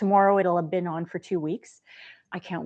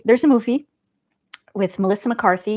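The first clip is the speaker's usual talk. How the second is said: ordinary delivery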